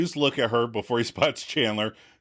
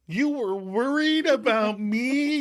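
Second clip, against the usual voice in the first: much higher, slow and goofy; silly mock concern; 12 semitones higher, slower than usual. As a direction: turn the words into a playful mock question. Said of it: "going all silly again".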